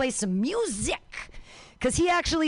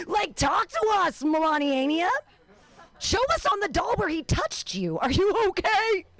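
higher, pleading mock concern; 7 semitones higher than usual. Comic talking to Melania as if rescuing her, mock concern. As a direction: coax her like a worried counsellor.

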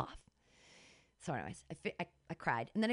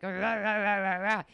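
aggressive men grunting; 4 dB louder than usual. Retold or imitated. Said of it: "getting all like".

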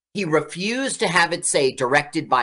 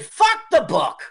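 dismissive director; 12 semitones higher, 4 dB louder than usual. Retold or imitated. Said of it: "the director just said".

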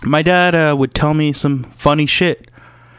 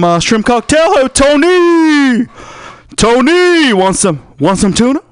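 shouting fish vendor; 11 semitones higher, 5 dB louder than usual. Retold or imitated. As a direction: bellow like a market vendor hawking seafood. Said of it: "The guy at the Swan Oyster Depot says".

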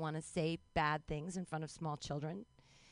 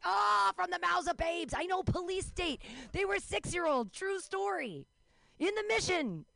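excited high-pitched; 11 semitones higher, 4 dB louder than usual. Real, not acted.

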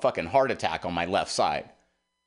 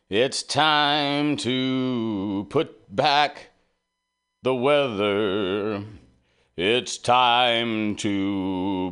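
slow dramatic dedication; much slower than usual. Acted. Radio show dedication, slow declaiming.

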